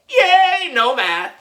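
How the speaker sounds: put-on high, loud kid cheer. A narrator's reading. Boy cheers when the recess bell saves him from math. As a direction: shout for joy at the bell.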